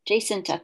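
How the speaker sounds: Jacinta repeated as "jay-SIN-tah"; echoing corrected pronunciation. Shown as a demonstration. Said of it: "Oh my goodness".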